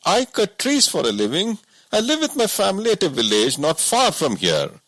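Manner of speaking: a simple woodsman who can't believe it; woodcutter voice. A narrator's reading. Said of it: "said Somana and sat down with a sigh".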